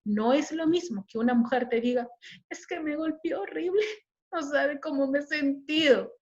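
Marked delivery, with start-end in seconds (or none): 2.5-6.1 high-pitched, half-giggled telling; laughing account. Shown as a demonstration. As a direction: recount it lightly, laughing it off. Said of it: "no es lo que te dice, sino cómo te dice".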